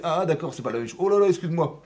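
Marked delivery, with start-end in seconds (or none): 0.0-0.5 higher, louder dawning "ah"; acted realization. Caïn seeing his brother's offering, acted realization. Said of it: "C'est ça que j'aurais dû faire". 1.0-1.8 high, loud mock-flustered apology; acted apology. Teacher acting a repentant Caïn to God, exclaimed apology. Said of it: "pardonne-moi, je me suis trompé".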